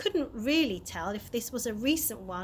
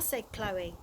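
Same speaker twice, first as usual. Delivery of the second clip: ordinary delivery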